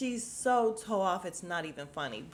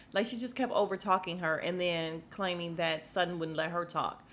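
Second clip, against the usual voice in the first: ordinary talk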